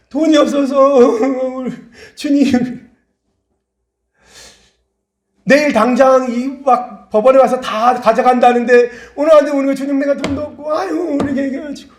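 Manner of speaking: very high-pitched imitated tearful plea; mock desperate wailing. Debtor begs the Lord before seizure, mock wailing. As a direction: wail hysterically, acting out a desperate debtor.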